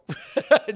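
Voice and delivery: short burst of laughter. Real, not acted. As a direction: laugh at her own comeback. Laughing at her own retort.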